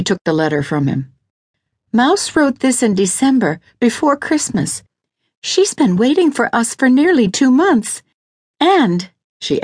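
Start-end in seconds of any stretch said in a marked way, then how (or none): 1.9-9.1 high-pitched woman's character voice, urgent; earnest, worried wife. Acted. Lydia argues that Mouse has been waiting, urgent. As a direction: press the case in a raised, higher voice.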